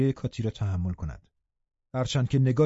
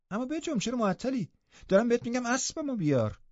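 high-pitched, impatient little mistress; imperious girl, growing impatient; bossy command; 7 semitones higher than usual. A narrator's reading. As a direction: order a servant about, pitch climbing. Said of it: "بعد ادامه داد".

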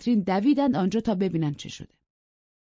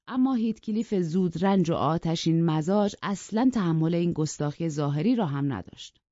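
plain reading voice

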